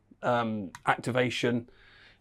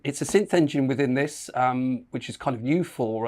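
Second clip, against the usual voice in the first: normal talking voice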